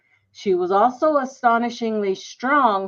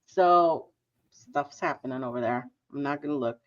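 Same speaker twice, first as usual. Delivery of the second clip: usual speaking voice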